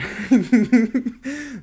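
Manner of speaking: big loud burst of laughter. Real, not acted. Laughing at how he sounded at the counter, loud burst. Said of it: "that's exactly how I sounded".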